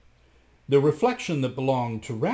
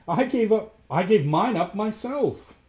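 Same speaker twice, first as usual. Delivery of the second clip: high, louder protest; 10 semitones higher, 4 dB louder than usual. A narrator's reading. Rasmus protests to the magicians, high and emphatic. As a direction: object with raised pitch. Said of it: "protested Rasmus".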